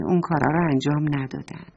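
plain reading voice

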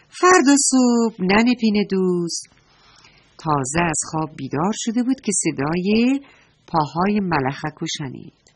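ordinary delivery, nothing marked

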